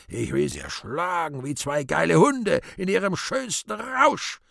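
high furious outburst. Acted. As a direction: rage boiling over, shrill peak. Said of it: "Gottvater immer zorniger".

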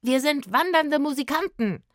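high, loud proud declaration; emphatic affronted announcement; indignant calling out. A narrator's reading. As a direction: proclaim it to the man, offended. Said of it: "rief er dem Mann zu".

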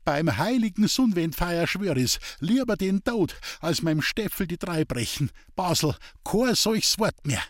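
high-pitched impassioned vow; passionate outcry. A narrator's reading. Leni swearing loyalty to her Steffel, passionately crying out. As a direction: cry it out as a fervent oath. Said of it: "indem sie leidenschaftlich ausrief".

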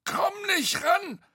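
very high, squeezed, halting voice; straining call. A narrator's reading. Bo reaching from atop Tete, high and straining. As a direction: strain upward in broken bits. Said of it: "rief Bo von oben".

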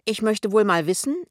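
high, loud appeal to onlookers; aggrieved public complaint; loud indignation. A narrator's reading. Kessler complains to bystanders.

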